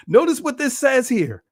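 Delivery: ordinary delivery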